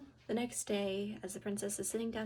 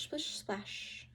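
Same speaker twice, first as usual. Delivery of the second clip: ordinary delivery, nothing marked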